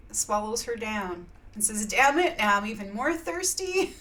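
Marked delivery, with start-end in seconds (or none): 1.9-3.9 loud, raised-pitch exasperation; hammed-up angry outburst; mock angry. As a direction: play a furious, frustrated bear. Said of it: "gets so angry".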